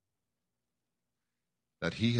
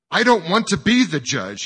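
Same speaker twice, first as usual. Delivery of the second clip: raised, high-pitched refusal; loud protest; 8 semitones higher, 10 dB louder than usual. A narrator's reading. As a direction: object loudly and desperately. Man protesting, standing up from judgment.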